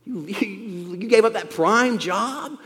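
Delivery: disbelieving mock, laughing through the middle; mocking scoff. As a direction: sneer it like a jeering onlooker. Others mocking Levi's choice.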